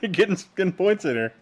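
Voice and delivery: giggling disbelief at a teammate; laughing. Real, not acted. Gamer laughs as his self-proclaimed bad friend scores.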